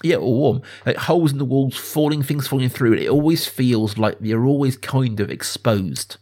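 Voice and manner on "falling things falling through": usual speaking voice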